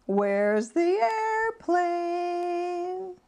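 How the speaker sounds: slow, high singsong coaxing; coaxing mother. Acted. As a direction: tease him like a sly mom. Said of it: "tried tricking him".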